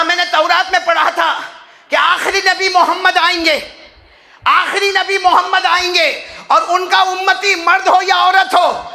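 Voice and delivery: high, loud impassioned preaching; preaching at full force. Acted. Preacher telling the congregation the story, in loud impassioned preaching. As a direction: call it out to the crowd with fervour.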